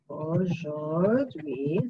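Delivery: aujourd'hui drawn out very slowly; slow model word. Shown as a demonstration. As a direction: stretch out aujourd'hui syllable by syllable.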